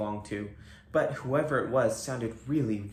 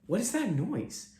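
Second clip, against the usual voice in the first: put-on boy asking; 8 semitones higher than usual. A narrator's reading. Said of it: "Stephen asked".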